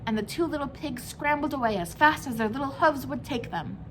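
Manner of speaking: plain reading voice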